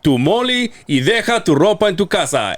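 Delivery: high-pitched Spanish event promoter. Shown as a demonstration. Host redoing a Spanish promo he recorded, promoter voice. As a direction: hype the crowd like a club promoter. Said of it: "a promoter voice".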